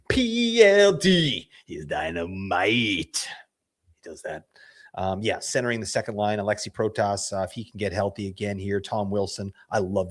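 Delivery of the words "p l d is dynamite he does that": hype drawl. Pretend. Hyping favourite player.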